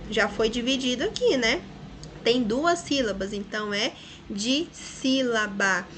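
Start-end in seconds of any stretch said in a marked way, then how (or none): 4.3-5.9 "dissílaba" slowly as DI - SÍ - LA - BA; syllable by syllable. Shown as a demonstration. Teacher classifies the word for pupils, syllable by syllable. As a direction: separate every beat of "dissílaba".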